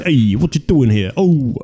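put-on Italian-American mobster voice; mock Sopranos gangster. Podcaster joking about The Sopranos, in a mock mobster voice. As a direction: play a cartoon New Jersey wiseguy. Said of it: "they're the falsettos".

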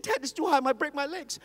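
put-on a toddler afraid of the height; scared little boy. Son on the table begging dad, high fearful child voice.

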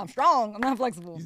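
loud, proud, laughing; loud declaration. Retold or imitated. Retold reply, loud, laughing.

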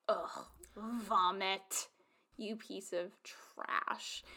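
slow, breathy whisper of disgust. Real, not acted. Host reacting to his speech with slow disgusted whisper. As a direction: hiss it out slowly, revolted.

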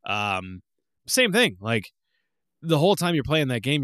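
usual speaking voice

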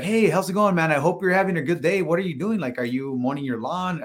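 acting out a chirpy sales opener; friendly greeting. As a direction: greet a stranger with easy friendliness. Acted friendly doorstep greeting.

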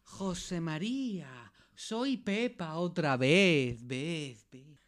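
slow, hushed call, the last word echoing off into the distance; slow echoing return. Acted. Pepa returns to José María, slow and echoing. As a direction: come back as the voice from beyond, letting it echo.

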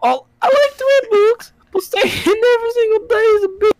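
put-on high girly voice, squeaky and light; man squeaking out a girl's part; man doing a woman's voice. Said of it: "He has to do it in a girl voice".

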